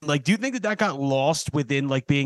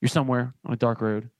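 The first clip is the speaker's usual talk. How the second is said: sponsor ad read; 5 semitones lower than usual. Acted. Off-the-cuff sponsor story, low and hushed.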